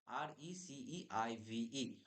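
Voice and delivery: each letter drawn out slowly, one by one; slow spelling out. Shown as a demonstration. Teacher spelling the word slowly, letter by letter. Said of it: "exact যে আমাদের বানানটা".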